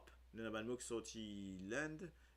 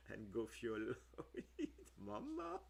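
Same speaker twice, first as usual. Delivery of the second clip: laughing fit. Real, not acted. Cracking up at a mispronunciation.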